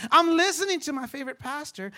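usual speaking voice

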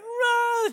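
loud high imitated toddler wail; child's crying wail. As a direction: burst into a mock toddler bawl. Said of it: "starts crying".

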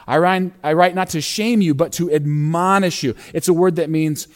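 ordinary talk

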